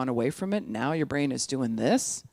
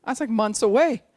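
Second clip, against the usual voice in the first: high, louder burst of dismay; 8 semitones higher, 5 dB louder than usual. Acted. Husband hearing wife say through Christmas, dismayed. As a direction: blurt it out, pitched up. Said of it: "he was like".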